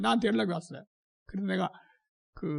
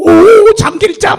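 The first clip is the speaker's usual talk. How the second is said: loud high shout of the name; chasing them down, yelling eagerly; loud excited shout; 7 semitones higher, 21 dB louder, much slower than usual. Retold or imitated. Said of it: "쫓아가가지고". (쫓아가며 크게 외침)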